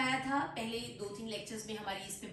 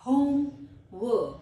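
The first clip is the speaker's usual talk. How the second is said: home and work as two separate louder words; split into parts; 7 dB louder than usual. Shown as a demonstration. Teacher dividing a compound word, parts said separately. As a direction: punch home, pause, then drop on work. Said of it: "syllables में divide करूंगी".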